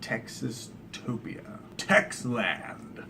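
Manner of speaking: slow whisper; slow hushed whisper as if unveiling a grand name; mock awed whisper. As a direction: breathe the name like a secret. Brainstorming names, whispered.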